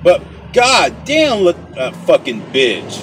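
cursing the other driver from behind the wheel; angry outburst. Real, not acted. Road rage at other driver.

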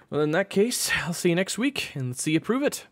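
high, loud coach voice; challenging coach. Acted. Coach calls the boy's bluff, challenging high voice.

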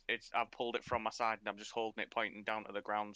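ordinary talk, nothing marked

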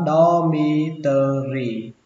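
"dormitory" slowly broken into do-mi-tơ-ri; syllable by syllable. Shown as a demonstration. Teacher first models the word for learners, slow and syllabified. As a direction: stretch out each syllable of "dormitory".

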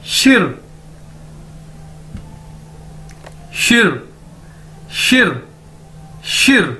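शिर drilled with a light r; model pronunciation. Shown as a demonstration. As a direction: repeat शिर slowly, r at end. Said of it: "तो कैसे बोलेंगे हम इसको?".

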